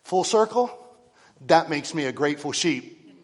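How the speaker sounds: preaching shout. Real, not acted. Preacher shouting his joy to the congregation. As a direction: blast it out to the back row.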